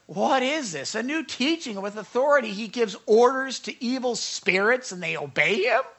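loud, high-pitched astonishment; amazed. Acted. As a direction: marvel to one another in disbelief. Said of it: "were all so amazed".

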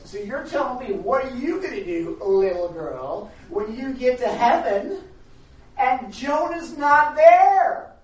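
loud, high taunt, slowing to a stretched climax; mocking lawyer. Lawyer's final challenge to little girl, mocking. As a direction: build the mockery to a drawn-out near shout. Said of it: "mocking her".

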